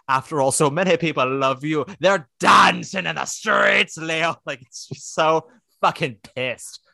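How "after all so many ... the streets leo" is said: imitating the Southern singer. Podcaster imitating the singer's angry Southern line. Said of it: "the way that [name] is like".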